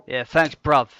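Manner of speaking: tough-guy London slang, half in jest; London chav accent. Pretend. Host thanks Ulysses in mock London chav. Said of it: "I don't usually talk like this".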